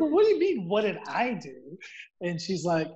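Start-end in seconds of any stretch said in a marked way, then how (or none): 0.0-1.8 surprised. Retold or imitated. Caught out by his wife's question.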